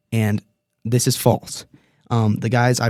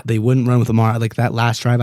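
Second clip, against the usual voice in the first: normal talking voice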